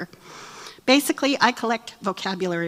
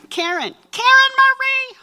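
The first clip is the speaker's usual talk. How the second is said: much higher, louder sing-song call; mother calling out; 7 dB louder than usual. Retold or imitated. Mom calling her grown daughter by full name, loud sing-song call. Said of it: "My mom calls me Karen".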